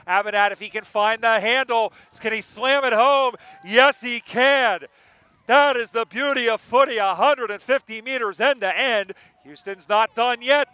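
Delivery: high, loud, rising roar as the goal goes in; excited goal call. Real, not acted. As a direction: call the goal at full throat. Commentator calling a goal.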